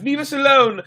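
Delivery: high, loud pleading shout; scared plea. Acted. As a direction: beg them to go, terrified. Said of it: "one of the boys cried".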